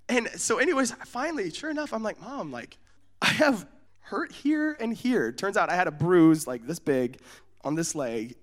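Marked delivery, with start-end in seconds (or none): 2.2-5.3 soft, slow explaining; a child carefully pointing out injuries; acted composure. Boy finally telling mom where it hurts, slow and soft.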